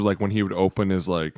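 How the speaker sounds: ordinary delivery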